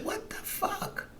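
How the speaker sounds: breathy whispered disbelief; hushed what-the-hell whisper; incredulous whisper. Retold or imitated. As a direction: whisper it in stunned exasperation. Exasperated reaction, retold.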